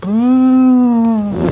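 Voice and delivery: high, loud two-note chime; imitated broken chime. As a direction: mimic a broken door chime, drawn out. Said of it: "let me try and imitate it".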